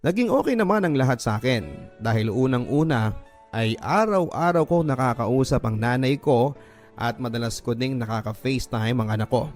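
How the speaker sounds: usual speaking voice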